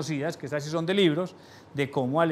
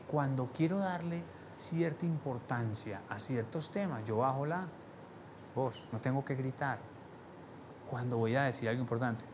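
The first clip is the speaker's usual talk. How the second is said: lowered voice; 3 semitones lower, 10 dB quieter than usual. Shown as a demonstration. Trainer showing students how he lowers his voice, softly. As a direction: drop the voice to make it matter. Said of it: "yo subo el tono de la voz y bajo".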